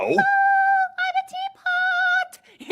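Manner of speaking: high, slow, squeaky teapot voice. Shown as a demonstration. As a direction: sing it out like a whistling teapot. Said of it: "would it have a strange voice like that".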